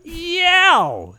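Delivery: high sudden yelp; yell. Acted. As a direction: yell it like a shock. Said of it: "was yell written out on the page".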